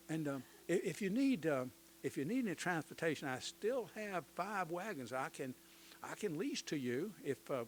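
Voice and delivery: a lightly put-on Southern gentleman's accent, eager to please; genteel Southern accent, gladly welcoming the federal officers. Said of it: "let me do this in my best Southern accent".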